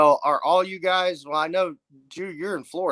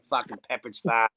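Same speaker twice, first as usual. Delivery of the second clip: put-on Boston accent; 2 semitones lower, 3 dB quieter than usual. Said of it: "You sound like you're from Boston".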